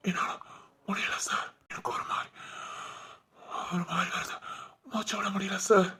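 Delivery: struggling for every breath, barely whispering; a dying man begging for the doctor in a weak whisper; breathless dying plea. Acted.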